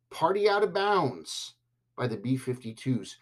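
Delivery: normal talking voice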